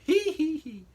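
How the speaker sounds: very high, loud falsetto squeal. Retold or imitated. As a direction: yelp it out in a falsetto. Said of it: "I can't sound like [name] except for going".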